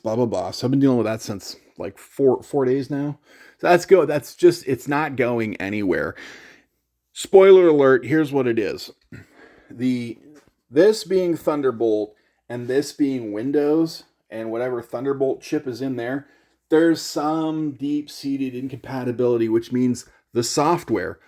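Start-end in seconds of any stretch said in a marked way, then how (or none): none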